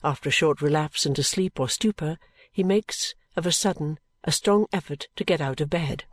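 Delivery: plain reading voice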